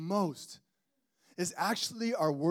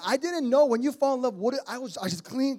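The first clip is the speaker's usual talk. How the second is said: normal talking voice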